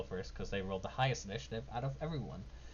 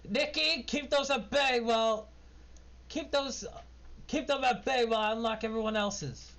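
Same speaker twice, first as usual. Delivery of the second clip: high, louder urgent halfling; Randall's voice; 13 semitones higher, 4 dB louder than usual. Acted. Randall tells Nikki to hold the drow off, high urgent voice. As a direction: bark orders while working the locks. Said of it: "Randall says".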